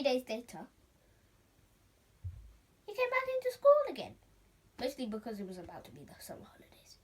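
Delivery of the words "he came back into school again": much higher, louder, joyful; triumphant return; excited. A narrator's reading. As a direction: announce the comeback.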